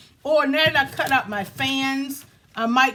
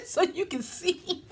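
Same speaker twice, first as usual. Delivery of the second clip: laughing; 6 semitones higher, 6 dB quieter, slower than usual. Real, not acted. Laughing at her messy desk.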